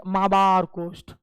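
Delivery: "माबार कोस्ट" said slowly with no L; slow, deliberate "माबार कोस्ट"; careful pronunciation. Shown as a demonstration. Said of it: "it is pronounced as". (धीरे और साफ़ "माबार कोस्ट")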